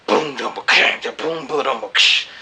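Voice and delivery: beatboxed drum pattern; drum imitation. The drummer playing over the track, a beatboxed drum beat. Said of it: "So he's like".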